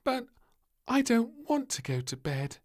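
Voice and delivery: high, digging in her heels; a small child refusing flatly; stubborn. Acted. Little Princess refusing bedtime, high and stubborn. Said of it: "said little Princess stubbornly".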